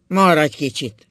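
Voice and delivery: slow, loud appeal; almost pleading. A narrator's reading. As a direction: ask her to stay, drawing out the words. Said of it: "mondta szinte könyörögve". (szinte könyörögve)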